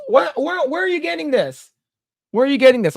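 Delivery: high-pitched, incredulous demand; baffled disbelief. Real, not acted. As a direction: challenge a comment you can't believe. Baffled reaction to a viewer comment.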